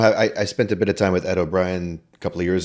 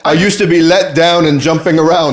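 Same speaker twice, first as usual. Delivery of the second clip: parody lyric; 10 semitones higher, 9 dB louder than usual. Pretend. Parodying the song Let Down.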